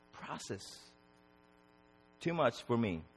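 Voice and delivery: soft, drained murmur; spent despair. Acted. Job overwhelmed before God, spent and quiet. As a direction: let the plea sink away, quiet and exhausted. Job's plea sinking into exhaustion.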